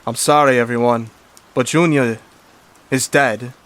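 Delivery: loud, solemn bad news; grave doctor. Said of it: "Archie then said to them".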